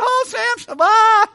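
high, loud hammed-up wailing; mock bawling scream. Preacher imitating the wife wailing at Samson in a high, loud mock scream. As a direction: wail her name like a sobbing wife. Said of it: "she's bawling her eyes out and screaming".

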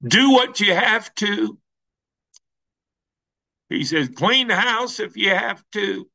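urgent pulpit command. Retold or imitated. Pastor quoting the elder's urgent charge, high preaching call. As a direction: press the command on them.

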